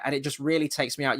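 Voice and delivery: usual speaking voice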